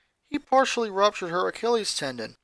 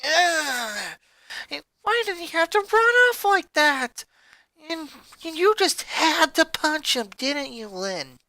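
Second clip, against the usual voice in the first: higher, whiny blame fading out; accusing; 10 semitones higher than usual. A narrator's reading. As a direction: pin the blame on her sister. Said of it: "the princess said accusingly".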